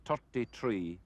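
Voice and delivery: "Thirty-three" with t in place of th; Irish hard t starting both halves of "Thirty-three"; Irish th pronunciation. Shown as a demonstration. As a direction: say "Thirty-three" as "tirty-tree". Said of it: "difficulty with pronouncing the T H sound".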